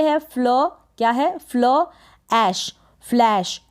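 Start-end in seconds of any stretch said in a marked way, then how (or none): 2.3-3.6 ऐश then फ्लैश, blended slowly; slow sounding out. Shown as a demonstration. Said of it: "एसएच को श पढ़ेंगे".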